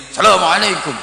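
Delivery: loud, high-pitched salam; high loud greeting. Retold or imitated. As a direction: call the greeting out high and hard. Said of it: "pakai nada tinggi keras".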